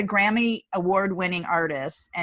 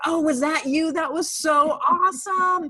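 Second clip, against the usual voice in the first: excited; 9 semitones higher, 3 dB louder than usual. Retold or imitated. Women on the beach cheering her.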